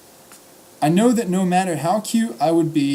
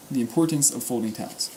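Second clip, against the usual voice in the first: quicker, lower-key title reading; understated title repeat; 3 semitones lower, 4 dB quieter, faster than usual. Real, not acted. Reader about to start the piece, understated title repeat.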